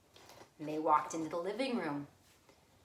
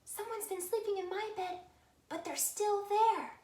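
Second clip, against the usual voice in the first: high, hushed little cub voice, fast; whispered Baby Bear; 13 semitones higher than usual. Acted. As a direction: squeak it under the breath.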